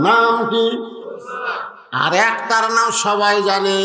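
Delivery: high, chanted preacher's roll call; call-and-response preaching. Real, not acted. Preacher asking the crowd the daughters' names, high chanting. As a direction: sing out each name for the crowd.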